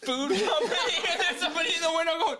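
high, giggling words pushed out between laughs; can barely get it out for laughing; laughing through it. Real, not acted. Telling Doug about the truck while laughing hard. Cracking up retelling car story.

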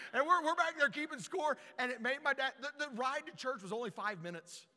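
ordinary talk, nothing marked

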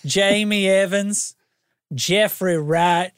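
slow, swooping Kentucky drawl; Kentuckian accent. Pretend. Host reading supporters' names on request, Kentuckian drawl. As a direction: stretch each name in a put-on Kentuckian accent. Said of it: "do it with a Kentuckian accent".

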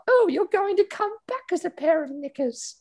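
high giggly posh lady voice; mock swooning aristocrat, laughing; laughing Camilla. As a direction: squeal it through a giggle.

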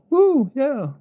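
high whooping cheer; rowdy party animal. Real, not acted. Cheering about being recovered. (ausgelassener Jubelschrei)

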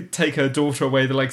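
usual speaking voice

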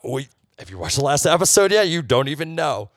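high, loud, insistent comeback; excited retort. Retold or imitated. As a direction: retell it as a loud, eager protest. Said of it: "I'm like".

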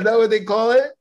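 high, loud amazement; genuinely taken aback; bright rising surprise; surprised question. Real, not acted. Surprised at new fact.

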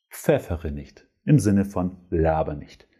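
usual speaking voice

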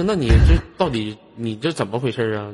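ordinary talk

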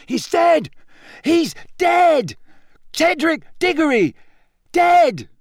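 high, loud shrieking cries; screeching crowd. Acted. The crowd screeching the news across the stands, loud. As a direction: scream it into the night. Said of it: "screeched it into the night".

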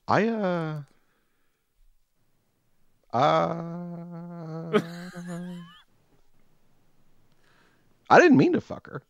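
high, stammering panic rising to a yelp; flustered panic. Retold or imitated. Answering his partner at home, flustered high panic. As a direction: blurt it out flustered and cornered. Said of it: "And I'm like".

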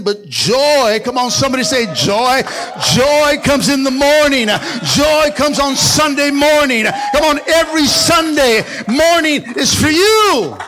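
loud, rising call of joy to the church; swelling Sunday-morning proclamation; full-force preaching. Acted. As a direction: preach it out big and high.

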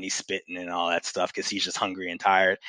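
ordinary talk

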